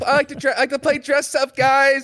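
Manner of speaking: high, sing-song mimicry of a friend who likes dressing up; mocking girly voice. Teasing friend about playing dress up, high mocking voice. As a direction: tease him in a high childish voice. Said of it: "you like to play dress up".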